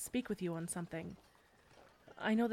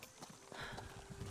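weary sigh; 13 dB quieter than usual. Acted. Weary sigh before leaving camp.

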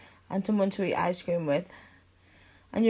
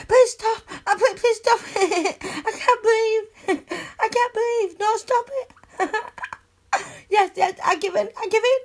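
high, loud begging through laughter; helpless ticklish laughing; 12 semitones higher, 5 dB louder than usual. Acted. Begging a best friend to stop tickling, laughing helplessly. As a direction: squeal the words out between giggles.

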